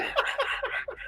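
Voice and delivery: sudden squeal of laughter; high-pitched laugh. Real, not acted. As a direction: burst into a shrill laugh. Bursts out laughing.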